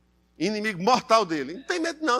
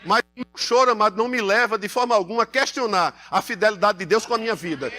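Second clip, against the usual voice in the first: usual speaking voice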